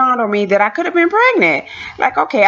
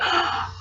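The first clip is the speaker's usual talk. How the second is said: quick imitated gasp; sharp intake of breath, acted; shocked gasp; 10 dB quieter than usual. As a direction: gasp as if stunned by news. Husband reacting to test.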